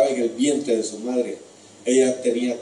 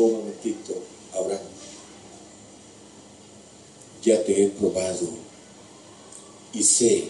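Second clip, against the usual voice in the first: voice of God. Acted. God's voice stops Abraham.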